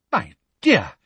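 a touch of shocked disapproval; scandalized. A narrator's reading. Professor to a student who cried out, mildly scandalized. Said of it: "in a scandalized voice".

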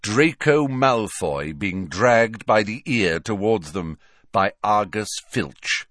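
ordinary delivery

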